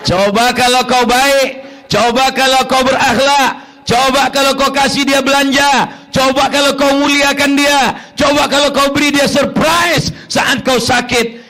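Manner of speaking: preaching at full force. Acted. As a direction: lay into the husbands from the pulpit. Preacher building scolding.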